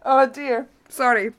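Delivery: giggly oh dear; sorry, still laughing; laughing apology. Real, not acted. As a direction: catch your breath between laughs. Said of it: "try not to laugh".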